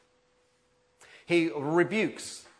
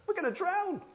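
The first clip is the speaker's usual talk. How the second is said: panicked cry. Retold or imitated.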